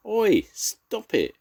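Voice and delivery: father rabbit yelling at the digger; shouting. A narrator's reading. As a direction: bellow at the soil flying. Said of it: "shouted Father Rabbit".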